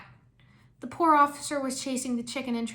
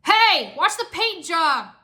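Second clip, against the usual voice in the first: slow, loud, higher yell; 7 semitones higher, 10 dB louder, much slower than usual. Acted. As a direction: shout it out, dragging it slow. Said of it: "Kai yelled".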